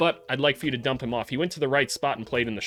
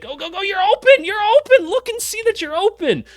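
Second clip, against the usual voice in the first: high, loud coaching yell; yelling at the player; 10 dB louder than usual. Real, not acted. Vlogger yelling at a player on film that he's open, loud and high. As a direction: shout him into the open lane.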